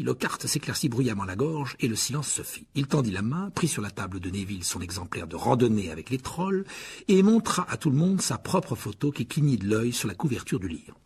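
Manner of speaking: ordinary delivery, nothing marked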